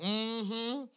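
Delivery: high, soft "mm-hmm"; knowing agreement. Retold or imitated. Congregation agreeing about other people's tongues, knowing high hum. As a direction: hum along in smug agreement. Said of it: "Some of you are like".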